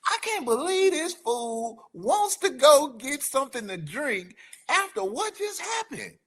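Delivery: high voice, a hint of incredulous wife; wife's look turned into words, lightly incredulous; disbelieving look voiced. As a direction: voice her exasperated look. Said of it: "the look that she gave me said".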